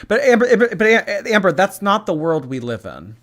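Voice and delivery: exasperated. Real, not acted. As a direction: call out to her in disbelief. Exasperated call to Amber.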